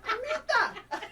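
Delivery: very high, loud, hammed-up hello; playful imitation of an excited friend; exuberant greeting. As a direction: call out affectionately through laughter. Repeats the greeting amid laughter.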